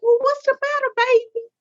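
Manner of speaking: high, sugary motherly coo; sweet mom voice. Retold or imitated. Mom soothing her daughter's nervous date, sweetly high. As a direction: coo at him like a doting mom.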